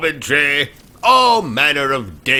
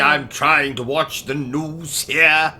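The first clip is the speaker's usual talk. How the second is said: put-on British accent; 2 semitones higher than usual.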